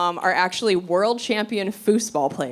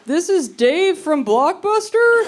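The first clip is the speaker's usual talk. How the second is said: clerk on voicemail; 7 semitones higher, much slower than usual. Retold or imitated. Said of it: "messages from people like".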